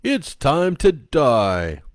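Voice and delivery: sinister puppet. Acted. Clock puppet telling the time, slow menacing puppet voice. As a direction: drag out the threat.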